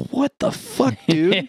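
high-pitched acted-out bafflement; mock disbelief. Answering a man who says he's God, mock disbelief. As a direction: imitate a stunned man, voice way up. John hears Sutter is God.

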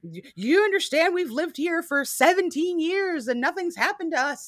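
high-pitched lightly mocked outrage; mock indignant lady. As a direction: play her wounded self-righteousness, pitch up. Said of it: "She's screaming about".

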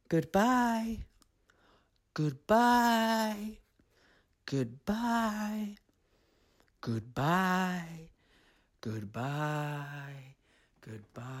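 a voice echoing away across the sea; fading echoed call. A narrator's reading. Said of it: "Serena's whispered call".

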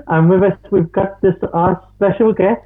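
put-on high voice in an unplaceable accent; high comic lady character; Factual Fanny. Host attempts the episode in a high, silly accent. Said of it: "this sort of accent".

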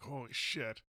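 very soft startled aside; hushed mock alarm. Tailgater creeping up reacts to slowdown, hushed mock alarm. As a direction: let it slip out under the voice.

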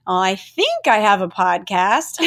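PODCAST lifted into a doubtful question; unsure upturn. Shown as a demonstration. Host mimics herself introducing her show, unsure and upturned. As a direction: drag "I think" and curl PODCAST up as if asking. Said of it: "doing that sometimes when I'm unsure".

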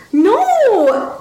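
put-on high childlike cry of protest; very high, drawn-out refusal; girl's shriek. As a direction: shriek like a struggling little girl. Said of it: "she shrieked".

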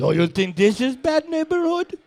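high, put-on Russian voice; a Russian heavy warning a stranger; lifted-pitch Russian accent, no articles. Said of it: "That sounds like Archer's dad".

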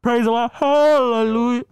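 loud, slow preacher-style yell. Pretend. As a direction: bellow it out in praise. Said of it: "Why are you yelling?".

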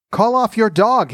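understated shout of terror, pitched well up; terrified shout. A narrator's reading. Caller barked at by a glass dog, mildly terrified shout. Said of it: "he shouted in terror".

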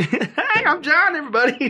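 high, chirpy hello through laughter; perky newcomer introduction. Acted. Renamed boy introducing himself to everybody, mock-cheery and high.

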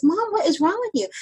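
a hint of the daughter's worried question.

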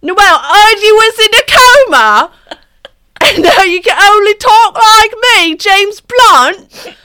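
loud, high, hammed-up British accent; over-the-top British celebrity impression. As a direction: shout it in an exaggerated British accent. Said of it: "I'll do my really elegant British accent".

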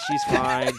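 giggling while insisting; laughing. Real, not acted. Defending himself to a teasing friend, laughing. Laughing after being teased.

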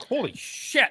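high startled exclaim; jolted by a bite, yelp it out; shocked. Retold or imitated. Father startled when a toddler bites him. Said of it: "I was like".

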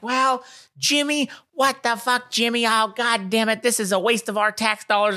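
high-pitched imitation of an angry grandma; yelling grandma. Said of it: "His grandma's yelling at him".

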